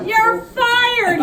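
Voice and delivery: very high, loud roar; booming fury; thundered. Acted. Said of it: "he thundered".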